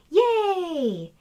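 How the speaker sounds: high, loud group cheer; class cheer. Acted. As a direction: cheer it out together.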